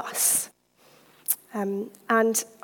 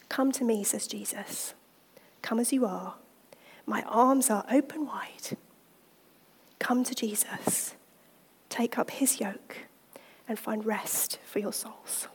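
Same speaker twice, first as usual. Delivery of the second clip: whispered invitation; 6 dB quieter than usual. Real, not acted. Preacher closing softly, inviting women to Jesus. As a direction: hush and invite them gently.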